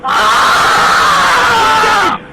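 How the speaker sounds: raw scream of pain, full voice; loud pained scream. Real, not acted. Man being restrained screams in pain. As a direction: let out a real cry of pain. Pain during arrest struggle.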